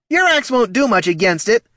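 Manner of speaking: high, loud gloating voice; crowing guard. Acted. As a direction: brag smugly about the gate.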